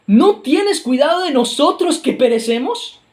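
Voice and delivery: high, loud panicked plea; frightened cry. Acted. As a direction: cry out to the master as the boat sinks. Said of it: "le gritan a Jesús".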